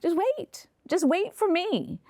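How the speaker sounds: high-pitched, lightly voiced instruction; God's gentle urging. God telling a worried mother to wait, lightly voiced. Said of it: "God was telling me".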